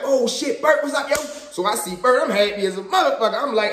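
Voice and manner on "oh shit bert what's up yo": higher, with a laugh, glad surprise; excited greeting. Retold or imitated. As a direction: greet an old friend with a happy laugh. Happy to see friend.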